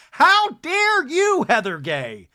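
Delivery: high, loud, slow HOW DARE YOU; real outraged high How dare you. Real, not acted. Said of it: "I'm heated now".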